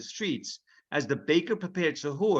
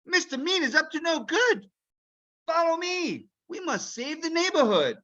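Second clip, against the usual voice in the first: put-on high urgent boy's voice; rally your friend; eager boy hero; 11 semitones higher than usual. Ilyas leading Duck to save town, heroic urgency.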